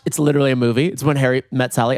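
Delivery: ordinary delivery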